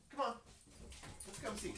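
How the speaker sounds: ordinary talk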